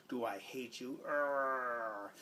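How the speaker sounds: goofy put-on voice ending in a mock groan; silly voice. Aunt clowning for giggling kids after timeout, silly put-on voice. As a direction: ham it up to make the kids laugh. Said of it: "And then I'll get silly".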